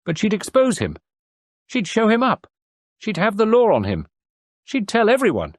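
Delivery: pitched well up, building outrage; rising indignation. A narrator's reading. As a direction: let the indignation run away with you. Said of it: "the tide of her indignation".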